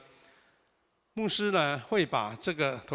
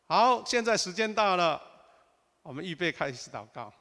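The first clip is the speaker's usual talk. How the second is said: raised, louder announcing voice; leader calling time; 3 semitones higher, 3 dB louder than usual. Shown as a demonstration. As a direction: call the group to start, higher and louder. Acts out leader opening group prayer.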